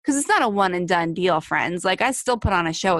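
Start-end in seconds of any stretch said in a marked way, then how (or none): none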